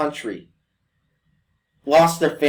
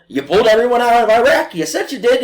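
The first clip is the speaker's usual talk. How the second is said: a higher, louder challenging question; mock accuser; 9 semitones higher, 4 dB louder than usual. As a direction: jeer like a doubting crowd. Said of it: "when everybody went".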